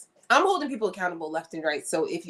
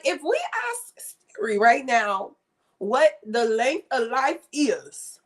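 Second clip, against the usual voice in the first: ordinary talk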